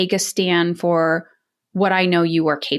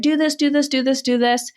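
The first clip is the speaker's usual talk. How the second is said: mock nagging yell; 5 semitones higher than usual. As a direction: rattle off orders like a nagging parent, mock yelling. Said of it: "yelling constantly in the house".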